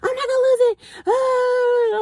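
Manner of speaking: put-on high drunk girl insisting. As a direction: squeal it high like a wasted friend. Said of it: "She was like".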